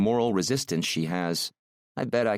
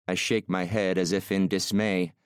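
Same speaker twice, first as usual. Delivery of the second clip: ordinary delivery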